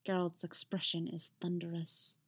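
usual speaking voice

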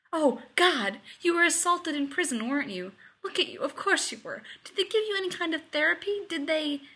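high, urgent questioning; panicked. A narrator's reading. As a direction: fire off worried questions in a panic. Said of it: "his expression panicked".

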